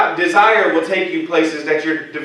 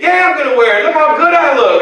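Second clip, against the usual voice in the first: proud show-off; 9 semitones higher, 4 dB louder than usual. Retold or imitated. Showing off clothes to wife.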